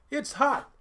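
high, insistent complaint; quick bickering claim; middle son insists. A narrator's reading. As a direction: snap that it's hot. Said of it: "said the middle son".

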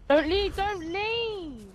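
high, drawn-out "don't leave"; high-pitched real pleading; urgent plea. Real, not acted. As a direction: plead with them, stretching the words. Pleading with teammate in match.

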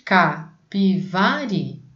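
Capivari drawn out slowly, each syllable apart; slow syllable reading. Shown as a demonstration. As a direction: spell Capivari out by sound, slowly. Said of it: "Qual é a primeira sílaba". (Capivari devagar, separando as sílabas)

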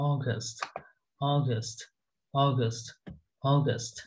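অগাস্ট with a round "aw" and a full Bangla-accented flow; অগাস্ট said the Bengali way, aw-gast; Bangla accent English. Shown as a demonstration. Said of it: "উচ্চারণটা হবে হচ্ছে অগাস্ট".

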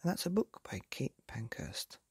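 ordinary delivery, nothing marked

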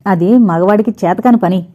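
ordinary delivery, nothing marked

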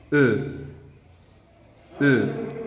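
"E" with rounded lips. Shown as a demonstration. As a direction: hold a rounded closed "E". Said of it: "decimos la E y redondeamos los labios".

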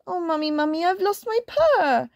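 put-on plaintive kitten voice; crying little kitten. A narrator's reading. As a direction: wail like a tiny upset kitten. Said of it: "Why are you crying?".

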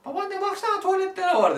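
high, loud put-on child voice; child calling grandma. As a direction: call grandma urgently to come and look. Acted child calling grandmother to look. (babaannesine seslenen çocuk taklidi)

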